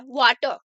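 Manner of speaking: वाटर said as "waa-tuh", the final r silent; r dropped. Shown as a demonstration. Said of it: "silent होता है".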